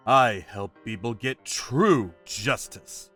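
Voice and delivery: ordinary delivery, nothing marked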